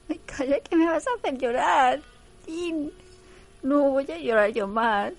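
high, loud hammed-up weepy wife; mock tearful Sun. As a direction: overdo the soap tears. Sun tearfully answers Jin.

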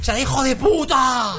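high, slow hammed-up insult; mock insult. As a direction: drag it out high and indignant. Retelling Paco's insult in sketch.

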